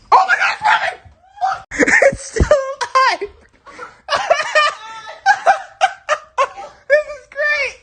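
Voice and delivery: high laughing yells; laughing shouts. Real, not acted. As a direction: shriek with delighted laughter. Bird runs, laughing shouts.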